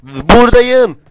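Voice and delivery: loud, high shout falling at the end; calling out. Real, not acted. Player calling out where he is, loud high holler. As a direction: holler out your position.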